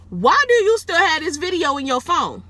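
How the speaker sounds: high, loud incredulous question; retold high-pitched yelled question; shouted demand. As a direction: demand it in outraged disbelief. Confronting the man in retold quote.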